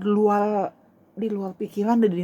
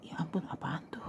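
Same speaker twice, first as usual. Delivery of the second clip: whispered inner reaction; very soft, near-voiceless muttered thought; hushed dismay; 14 dB quieter than usual. Retold or imitated. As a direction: whisper it to yourself, unsettled. Retold frightened thought to herself.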